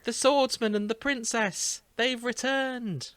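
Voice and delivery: put-on high, slow, excited child's cry; squeaky little girl. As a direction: announce their return like a thrilled little girl. Voicing the squeaky girl Joshua.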